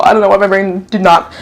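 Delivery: usual speaking voice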